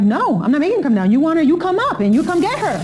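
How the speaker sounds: high-pitched retold defiance; defiant refusal. Sister standing up to him over Liza, defiant refusal. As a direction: refuse emphatically, pitch jumping way up. Acted defiant reply in quarrel.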